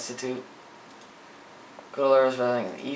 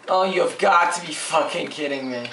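ordinary talk, nothing marked

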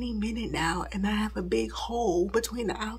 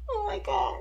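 on the verge of tears; 9 semitones higher than usual. Real, not acted. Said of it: "on the verge of tears".

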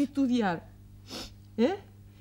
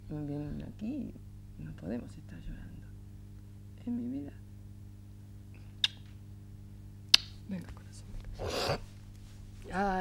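hushed motherly comfort; tender comforting; 6 semitones lower, 10 dB quieter than usual. Acted. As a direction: soothe a child, almost under your breath. Mother comforts crying son.

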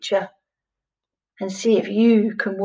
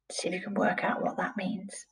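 whisper; 2 semitones lower, 13 dB quieter than usual. Acted. Teacher whispers a challenge.

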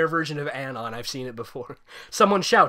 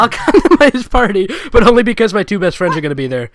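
loud excited; 6 semitones higher, 14 dB louder than usual. Acted. Acted decision line.

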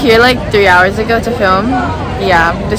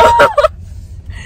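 high-pitched laugh. Real, not acted. Laughing at drive-thru mishap.

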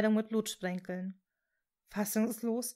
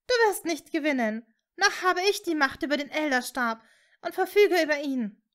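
loud, raised, defiant declaration; 5 semitones higher, 7 dB louder than usual. A narrator's reading. Hermine challenges Voldemort over the wand, loudly defiant. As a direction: stand up to him, high and loud. Hermine defies the dark lord.

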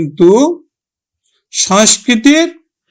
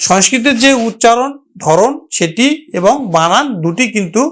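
ordinary talk

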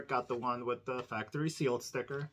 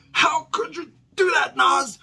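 much higher and louder, hammed-up sobbing; mock crying wail; 13 semitones higher, 12 dB louder than usual. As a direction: wail it like a betrayed fan, fake tears. Mock heartbroken fan, wailing.

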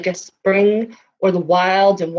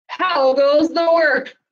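slow farmer call; 8 semitones higher, slower than usual. Acted. Farmer asks again.

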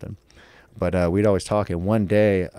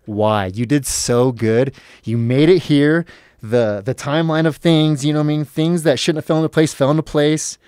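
raised, loud, anguished self-berating; exasperated self-scolding; 6 semitones higher, 6 dB louder than usual. Retold or imitated. Berating himself after blowing the interview, loudly exasperated.

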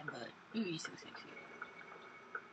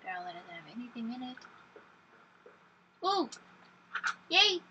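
ordinary delivery

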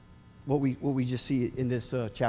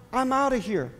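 breezy high brush-off; mock-casual I'm outta here; Jonah's flippant exit; 8 semitones higher than usual. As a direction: toss it off like running away. Voicing Jonah fleeing.

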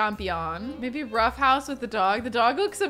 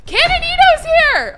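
much higher, loud cry of delight; excited announcement; 12 dB louder than usual. Real, not acted. Gamer thrilled that her favourite Sim arrived, shouting.

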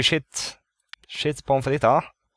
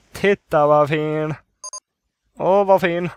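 louder, slow, gushing admiration; drawn-out admiration; 5 semitones higher, 5 dB louder, slower than usual. Real, not acted. Player admiring the new pool, gushing and drawn out. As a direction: stretch the words out in loud delight.

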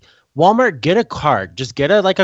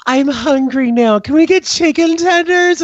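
put-on high, louder childish whine; sick kid asks for chicken tenders; whiny kid; 4 dB louder than usual. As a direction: play the careless little boy asking for food. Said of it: "And he's like".

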